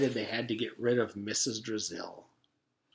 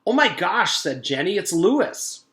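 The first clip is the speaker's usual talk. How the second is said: high, loud gasp of recognition; shocked realization; 6 semitones higher, 7 dB louder than usual. A narrator's reading. Girl suddenly recognizes the shaved yard teacher, loud surprise. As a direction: blurt it out in total surprise.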